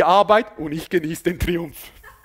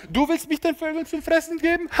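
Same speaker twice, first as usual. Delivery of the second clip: high, soft mocking voice; mocking incredulity; 6 semitones higher, 5 dB quieter than usual. David answering Goliath's threat to feed him to birds, mocking. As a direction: parrot the threat back in a silly high voice.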